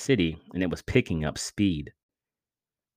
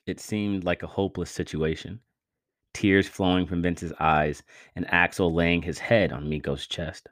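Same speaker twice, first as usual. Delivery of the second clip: ordinary delivery, nothing marked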